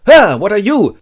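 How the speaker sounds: high, loud mock shock; startled. Hobbits meet a mysterious guy, high startled cry. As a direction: yelp the question in alarm.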